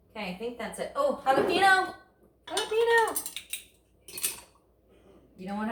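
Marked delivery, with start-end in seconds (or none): 0.9-3.3 high-pitched delighted cry; sudden excited exclamation. Real, not acted. Cook suddenly remembering the jalapenos while topping a fajita, high excited cry. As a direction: sing out the word high, as if just remembered.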